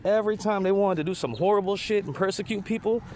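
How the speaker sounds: usual speaking voice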